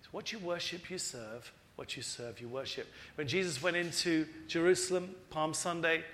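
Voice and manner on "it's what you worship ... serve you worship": softer and lower, "you serve" almost hushed; quiet repeat. Shown as a demonstration. Preacher repeating Jesus' words softly to the congregation. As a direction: say "what you worship, you serve" again quietly, low and soft. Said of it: "But Jesus knows that".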